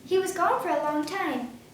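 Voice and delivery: usual speaking voice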